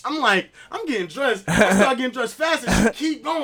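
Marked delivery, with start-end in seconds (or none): none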